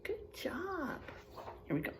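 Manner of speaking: soft whispered praise. Real, not acted. Teacher whispering praise to a student, hushed. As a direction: breathe it out gently.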